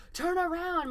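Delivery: mock director's shout. Host retells director shouting at contestant, high half-hearted shout. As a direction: call it out high, only pretending to shout. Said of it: "he's shouting".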